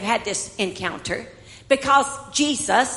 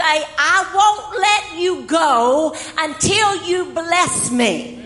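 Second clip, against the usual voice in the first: desperate plea; 5 semitones higher, 5 dB louder, much slower than usual. Acted. Jacob pleading, voiced by preacher.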